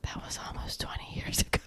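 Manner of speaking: hushed whispered aside. Retold or imitated. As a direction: breathe it out in a whisper. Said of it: "I was like".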